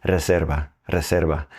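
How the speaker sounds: "reserva" with rolled r and stress on "-ser-"; Spanish pronunciation. Shown as a demonstration. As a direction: model "reserva" twice in clear Spanish. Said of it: "you're gonna see this one instead".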